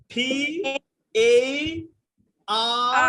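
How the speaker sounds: ফি, এই, আর each pushed out loud and fondly; coy exaggerated sounds. Shown as a demonstration. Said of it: "একটু ঢং করে আহ্লাদের সাথে".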